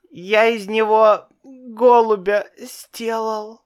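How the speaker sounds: usual speaking voice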